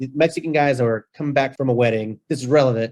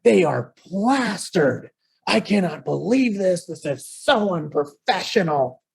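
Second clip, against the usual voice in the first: higher, slow, appalled put-on voice; indignant mom; 6 semitones higher, slower than usual. Guest mom complaining to the host about the drunk workers, appalled. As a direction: drag each word out in disbelief.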